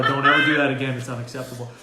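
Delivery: loud stern telling-off, then dropping; imagined scolding. Pretend. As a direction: bark it at her like a dog trainer. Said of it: "put a prong collar on this lady and tell her".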